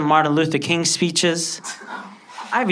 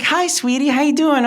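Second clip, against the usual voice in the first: friend's mom; 11 semitones higher than usual. Retold or imitated. Friend's mom greets sweetly.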